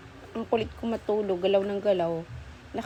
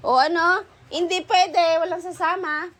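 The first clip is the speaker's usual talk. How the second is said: high scolding; 5 semitones higher, 4 dB louder than usual. Real, not acted. Telling dogs they can't come.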